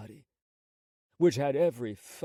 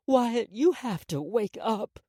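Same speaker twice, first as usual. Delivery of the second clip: slow, high, urgent plea; desperate plea; 8 semitones higher, 5 dB louder than usual. Acted. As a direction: press each word, slow and desperate. Mother shaking dead son.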